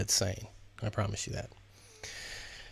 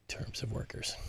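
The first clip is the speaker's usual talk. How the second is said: whispered aside; 10 dB quieter than usual. Real, not acted. Joke aside about the crew.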